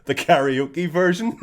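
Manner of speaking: high, loud, slow, laughing; laughing punchline. Retold or imitated. Punchline quoted while laughing.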